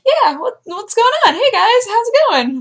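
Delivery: very high, goofy and oblivious; dazed dog voice. Retold or imitated. Dog after crashing into rock, high goofy oblivious voice. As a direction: play the dog cheerfully clueless. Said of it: "it was just like".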